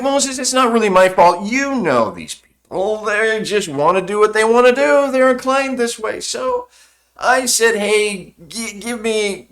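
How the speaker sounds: high, wheedling excuse-maker voice; whiny excuse-making Aaron. Acted. Aaron pleading with Moses, high wheedling excuse. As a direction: shrug it off like a guilty brother pleading innocence.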